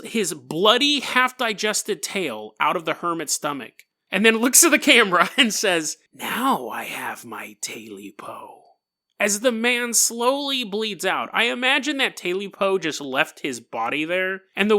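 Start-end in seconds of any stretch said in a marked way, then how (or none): 6.1-8.6 slow, low creature whisper; sinister whisper. Acted. Creature holding his tail, speaking to the camera, sinister whisper. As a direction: whisper it slowly as the chilling last line.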